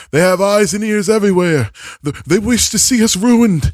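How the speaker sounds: high, loud prophet of doom; old man doomsayer. Acted. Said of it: "your old man Doomsayer impression".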